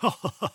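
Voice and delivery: a little knowing chuckle to himself; fox chuckle. Acted. Fox chuckling at the boastful gingerbread man, short and sly. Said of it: "The fox chuckled".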